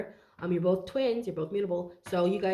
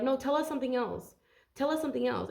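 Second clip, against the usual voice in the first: higher-pitched complaining quote; mock demanding crowd; 6 semitones higher than usual. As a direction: imitate people insisting on another answer. Voices the unhappy crowd demanding.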